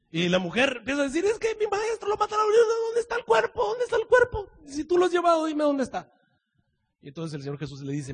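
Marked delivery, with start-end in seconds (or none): 1.2-6.1 high, anguished woman's voice; pleading Mary. Acted. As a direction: beg him to say where the body is. (María suplicando con voz aguda)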